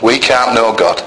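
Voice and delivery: ordinary talk, nothing marked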